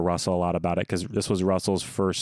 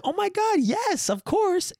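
very high, gushing fake thrill; fake delight; slower than usual. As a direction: squeal it like you love the gift. Faking delight at regifted present.

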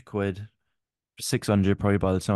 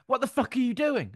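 imitating a grumpy friend, pitched higher; a hammed-up snap, much higher; mock angry outburst. Friend mocking a grumpy mate getting into the car, mock angry outburst. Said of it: "and then you get in and you go".

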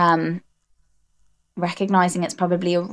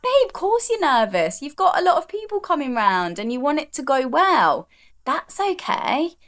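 much higher, gentle reassuring voice; compassionate self-talk; 8 semitones higher than usual. Retold or imitated. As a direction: comfort a nervous child, slowing at the end. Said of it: "that compassion".

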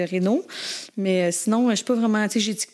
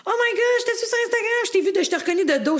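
excited fan; 11 semitones higher than usual. Retold or imitated. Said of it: "quelqu'un qui dit".

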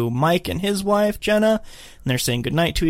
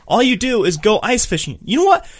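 mock indignant; 8 semitones higher, 4 dB louder than usual. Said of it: "And he's like".

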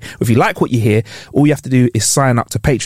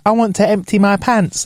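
mock little kid; 6 semitones higher than usual. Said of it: "it's cute when a kid goes like".

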